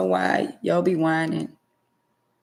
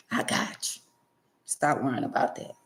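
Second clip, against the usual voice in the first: soft reassuring God; 3 semitones lower, 6 dB quieter than usual. Retold or imitated.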